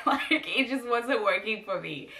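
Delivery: high, loud laugh through the words. Real, not acted. Vlogger laughs about the gas not working. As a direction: giggle while admitting it failed.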